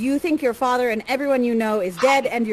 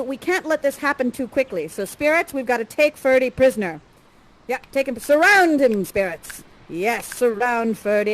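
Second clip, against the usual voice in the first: ordinary talk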